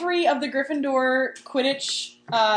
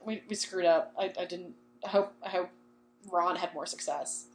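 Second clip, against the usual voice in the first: softer, halting, mildly defeated; acted dejection; 4 semitones lower, 8 dB quieter than usual. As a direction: stammer it out softly, like admitting failure. Acted dejected return after rejection.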